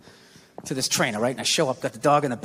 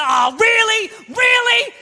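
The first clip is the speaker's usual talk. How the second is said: high, climbing incredulous yell; exasperated outrage; 12 semitones higher, much slower than usual. Retold or imitated. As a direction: rage at a crashed computer, pitch climbing. Raging at a crashed computer.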